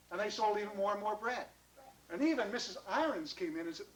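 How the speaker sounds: ordinary delivery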